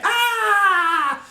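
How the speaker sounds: very high, mock child's shriek; little kid scream. Reviewer imitating Arlo's scream as a high childlike shriek. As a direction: squeal like a scared little kid. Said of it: "like a little kid screaming".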